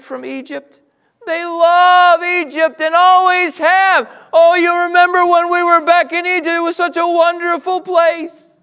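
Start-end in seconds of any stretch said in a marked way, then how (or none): 1.3-8.6 mock nostalgic whine. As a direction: ham up the longing for Egypt. Mocking Israelites' nostalgia.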